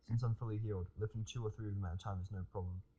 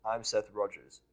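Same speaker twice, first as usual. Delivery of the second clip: stammering; 8 dB louder, much slower than usual. Acted. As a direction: caught off guard, stammering it out. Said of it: "I stammer a bit".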